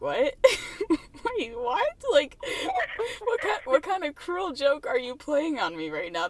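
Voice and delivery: mock outrage breaking into laughs; incredulous. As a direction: retell a stunned reaction through laughter. Acting out her stunned reaction.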